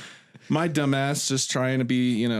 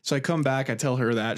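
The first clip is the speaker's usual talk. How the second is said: normal talking voice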